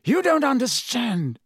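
high, pressing plea; begging Harry to listen, urgent. A narrator's reading. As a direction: make him listen, urgently. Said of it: "a note of urgency in his voice".

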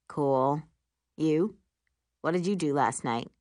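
put-on deep, manly boyfriend; low, laid-back casual question; Will. A narrator's reading. Boyfriend asking about her night, deep voice. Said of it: "says Will's deep, manly voice".

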